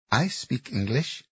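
put-on slow, careful Thai-accented English. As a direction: speak it with a heavy Thai accent. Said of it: "said in a heavy Thai accent".

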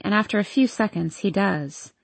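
plain reading voice

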